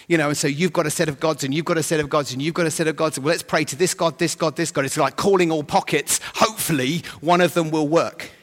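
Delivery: ordinary talk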